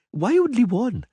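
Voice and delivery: put-on high girlish voice, stubborn and petulant; a spoiled little princess making her case; young girl Ciri. A little princess arguing with the Witcher, high girlish voice.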